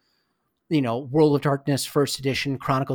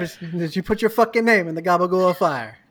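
put-on hammy Italian gangster accent; mock mobster in an Italian accent; bad Italian accent; 4 semitones higher, 4 dB louder than usual. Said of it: "sorry for the very bad Italian accents".

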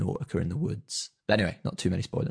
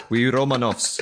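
put-on Russian accent; 3 semitones higher, 5 dB louder than usual. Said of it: "if I can do a Russian accent".

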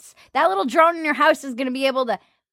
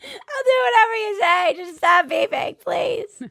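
high, whimpering mock plea; pleading with Alexa; 10 semitones higher than usual. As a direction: beg the machine to stop, near tears. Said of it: "You're like".

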